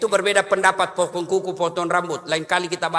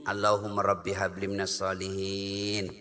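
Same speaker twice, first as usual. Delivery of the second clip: put-on soft prayer to child; 13 semitones lower, 11 dB quieter than usual. Mother murmurs the prayer to her child while feeding him, softly. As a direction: lower and much softer, murmured over the child. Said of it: "Ayo nak, mm, mm, mm".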